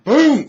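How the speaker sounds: loud, high booming burst; explosive boom. Acted. Game master voicing a fireball going off, explosive boom.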